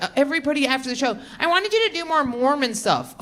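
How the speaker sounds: mocking audience member. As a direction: mimic the pushy fan after the show. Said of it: "you're like".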